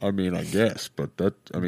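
deep, soft villain murmur; lightly voiced masked villain; Bane voice. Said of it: "I was Bane the whole time".

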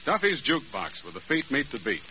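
normal talking voice

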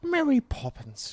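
high, slow, airy echo of the name; high sing-song Mary Poppins. Pretend. Answering his co-host, copying the high lilting name call.

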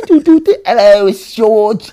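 high, loud put-on voice; George. Phone disguise for the skate shops, high put-on voice. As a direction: squeal it in a silly disguised voice. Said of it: "I'm gonna change my voice".

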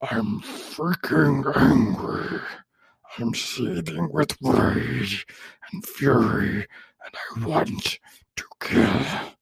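very slow, whispery raging monster growl; full-on seething monster, hammed up. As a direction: draw every word out in a harsh, voiceless monster rasp. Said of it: "Let me try that one more time".

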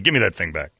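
ordinary talk

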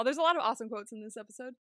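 ordinary talk, nothing marked

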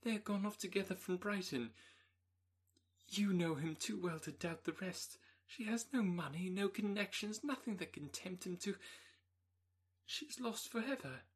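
high, trembling, on the edge of tears; distressed young woman. Acted. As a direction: pour out the terrible news, near breaking. Elizabeth tells Darcy dreadful news.

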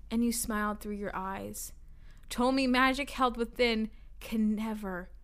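measured, lilting poem recital; poetry reading. Real, not acted. Reading mystery fan poetry to friends, measured recital. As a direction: read it as earnest verse with pauses.

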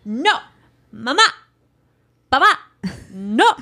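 lightly imitated bossy toddler; authoritative toddler. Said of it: "So now he's authoritative".

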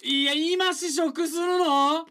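high, slow, loud disbelief; surprised. Real, not acted. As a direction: stretch it out in amused shock. Co-host eats snack on air.

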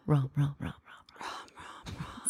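soft, trailing off into a whisper; fading echo. Pretend. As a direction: repeat it like an echo dying away. Fading echo of her own title.

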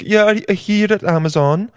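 put-on high, lilting Swedish-like accent; bad singsong Scandinavian-Italian accent; failed Italian accent, Swedish-sounding. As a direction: attempt Italian and land near Swedish. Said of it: "that's kinda Swedish".

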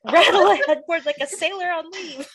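hammed-up eager bragging; imitating Phoebe. Friend quoting Phoebe to Mike's mom, high mock voice.

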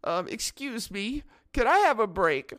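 very high, slow, meek put-on voice; timid lady. Quilting lady asking for a break, imitated meek voice. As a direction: plead feebly in a high old-lady voice. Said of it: "And then the lady goes".

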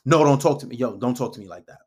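loud first burst, then low and firm; confrontational demo. Shown as a demonstration. Man overreacting to a girl's first mishap, confrontational. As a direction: check her too hard, opening with a forceful no. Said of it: "You don't have to, like, say".

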